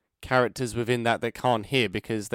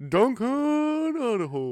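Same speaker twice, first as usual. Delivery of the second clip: high, slow drawn-out battle cry; Leroy Jenkins style; 14 semitones higher, 5 dB louder, slower than usual. Pretend. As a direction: call it out like a gamer charging in. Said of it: "Leroy Jenkins".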